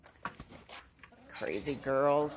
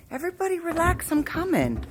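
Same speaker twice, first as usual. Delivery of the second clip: high and hurried; 10 semitones higher than usual. Real, not acted. Rushing chickens.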